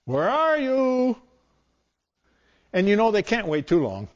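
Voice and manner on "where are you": high, slow sing-song call into the room; searching for a hidden child, high slow playful call; playful seeker calling out. Acted. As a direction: call out like a game of hide and seek. Pastor acts out hide and seek.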